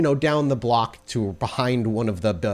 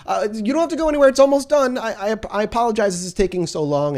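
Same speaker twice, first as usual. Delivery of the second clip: put-on much higher, fast flustered boy; panicked clerk; 12 semitones higher, 5 dB louder than usual. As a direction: rush out the apology in a panic. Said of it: "He's like".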